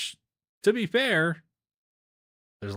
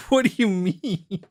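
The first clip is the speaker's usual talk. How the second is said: confused; 9 semitones higher, 6 dB louder than usual. Retold or imitated. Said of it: "I was so confused".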